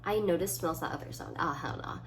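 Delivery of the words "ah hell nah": normal talking voice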